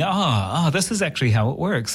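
as if it just clicked, lightly acted; dawning realization. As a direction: voice a sudden realization.

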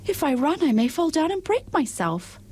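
high, timid china princess. Acted. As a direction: explain fearfully in a little voice.

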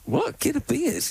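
high puzzled disbelief; surprised. Real, not acted. Misheard "go to bed.

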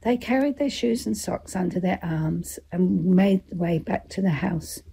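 usual speaking voice

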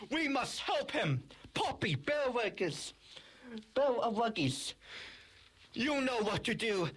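rally the troops with urgent orders. Acted. Leader toy rallies the troops.

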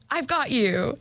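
spring the scare with a lift in pitch; sudden pounce. A narrator's reading. Creepy voice catches Ellen in bed, sudden pounce.

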